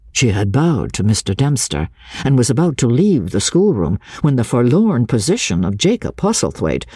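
ordinary delivery, nothing marked

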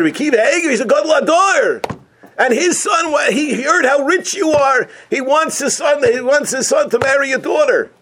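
high, loud sing-song sales pitch; scheming matchmakers. Acted. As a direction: sell the match in a high chant. Tricksters hype the match.

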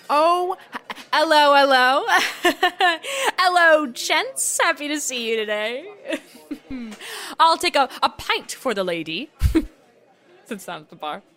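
a fake Brit trying hard to sound British; put-on British accent. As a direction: tourist in a British pub overdoing the accent. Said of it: "walking into a British pub".

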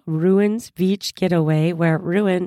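ordinary talk

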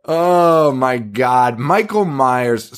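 slow, drawn-out words after laughing; long, slow comedown from a laughing fit; amused exasperation. Real, not acted. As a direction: stretch it out in amused disbelief. Recovering from shared laughing fit.

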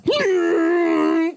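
high rising gasp; a rising, high-pitched gasp; imitated gasp. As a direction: act out your own startled gasp. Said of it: "that noise will make me go".

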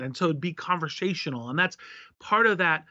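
normal talking voice

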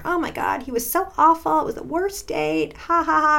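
high-pitched, animated imitation of gushing to a sister; retold hysterical phone gossip. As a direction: act out a giddy date debrief, topped with a mock laugh. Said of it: "we're laughing hysterically".

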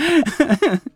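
a sudden high nervous laugh. Real, not acted. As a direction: laugh out of nerves, embarrassed. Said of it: "I'm sorry, I'm nervous".

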